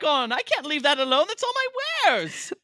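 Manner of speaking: high, loud, hammed-up indignant rant; outraged merchant. Merchant scolding whoever wants his backpack, mock outrage. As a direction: play a merchant protesting at the top of the voice.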